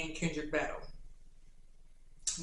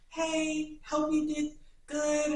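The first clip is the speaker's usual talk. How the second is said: high, drawn-out put-on voice; voice-note imitation; 9 semitones higher, much slower than usual. Imitating TikTokers recording a voice note, high put-on voice. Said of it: "recording a voice note and be like".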